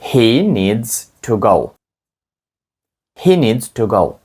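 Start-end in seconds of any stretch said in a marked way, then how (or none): 0.0-1.7 slow, every word separated; slow drill read; slow model sentence. Shown as a demonstration. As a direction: lay it out slowly for learners. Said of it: "тепер ще раз скажіть речення".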